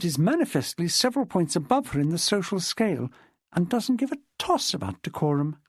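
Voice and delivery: scornful woman witness. Acted. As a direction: run down a snobbish acquaintance in a lifted voice. Jessica disparages Miss Chilmark.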